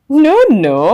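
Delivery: little man's voice. A narrator's reading. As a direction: tease her like a sly little imp. Little man answering.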